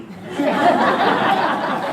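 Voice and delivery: high loud burst of real laughter. Real, not acted. Telling the server it's their anniversary, real loud laughter.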